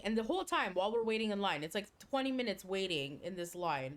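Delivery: ordinary talk, nothing marked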